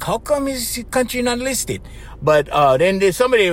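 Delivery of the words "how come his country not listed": higher-pitched wondering to himself; retold puzzlement. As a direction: wonder it in a raised, put-on voice. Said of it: "I was thinking, like".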